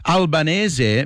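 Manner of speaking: Albanese the Italian way, al-ba-NEH-zeh; Italian pronunciation. Shown as a demonstration. Host correcting a texter, Italian pronunciation of Albanese. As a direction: pronounce Albanese carefully with an Italian accent. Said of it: "say it with an Italian accent".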